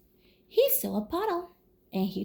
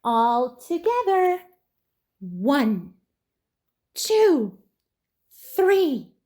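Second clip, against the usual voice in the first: louder, higher rallying count; 3 semitones higher, 6 dB louder, slower than usual. Acted. Reading to kids, counting the friends' big push. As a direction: heave on each number.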